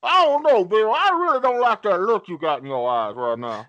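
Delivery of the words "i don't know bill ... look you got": wary high refusal. Acted. Reluctant victim in acted scene.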